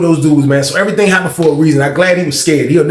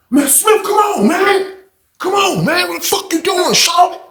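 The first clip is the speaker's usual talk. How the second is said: very high, yelled plea to stop; pleading shout; slower than usual. Retold or imitated. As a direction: holler at him to quit it. Jay yelling at Smith to stop.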